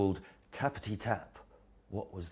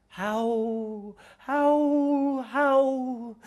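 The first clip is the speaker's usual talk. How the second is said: very high, loud howling wind sound; 8 dB louder, much slower than usual. Acted. The storm wind howling at Owl's house, loud and high.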